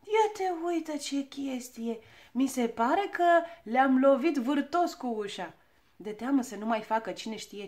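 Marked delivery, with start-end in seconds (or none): 0.0-5.5 slow, drawn-out puzzlement; puzzled mumble. A narrator's reading. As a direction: muse aloud, stretching the words. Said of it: "se scărpină în cap și mormăi încurcat". (nedumerit, tărăgănat)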